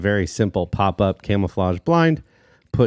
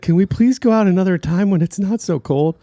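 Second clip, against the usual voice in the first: put-on pleading kid; 12 semitones higher, 6 dB louder than usual. Son pleading to dad.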